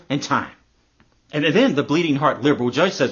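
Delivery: plain reading voice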